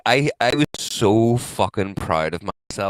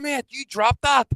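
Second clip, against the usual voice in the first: much higher and slow, innocent-sounding setup; sly fake warning; 4 dB louder, slower than usual. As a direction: lure him into looking down.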